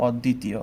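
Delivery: অদ্বিতীয় said with doubled দ, "od-dítio"; careful teacher's model of অদ্বিতীয়; correct pronunciation demo. Shown as a demonstration. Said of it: "আমরা এটাকে ভুল করে অদ্বিতীয় উচ্চারণ করি". (সঠিক উচ্চারণ দেখানো)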